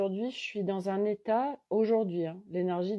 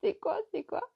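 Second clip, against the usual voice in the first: c'est quoi squeaked far higher; c'est quoi c'est quoi in a high squeaky voice; high bafflement; 12 semitones higher, 3 dB quieter than usual. Retold or imitated. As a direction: squeal it up in disbelief. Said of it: "Je me dis".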